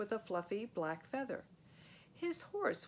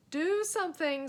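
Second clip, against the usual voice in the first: high, loud urgent plea; panicked plea; 9 semitones higher, 8 dB louder than usual. Acted. Fred begs Joe to act in panic. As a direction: push your friend to act, scared.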